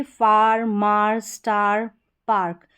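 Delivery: "फार, मार, स्टार, पार्क" each with a drawn-out open "aa"; long aa sound. Shown as a demonstration. Teacher reads out A-R examples for learners, long-aa vowel. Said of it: "ए का उच्चारण आ होता है".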